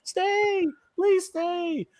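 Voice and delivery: high pleading mock voice. Host voicing a viewer's chat message, high mock plea. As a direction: beg them to stay in a squeaky high put-on voice. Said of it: "Neo's like".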